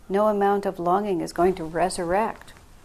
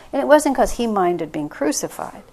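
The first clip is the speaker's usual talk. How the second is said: ordinary talk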